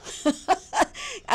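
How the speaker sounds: high, light laugh; laughing at own stumble. Real, not acted. Host laughs after stumbling over a name.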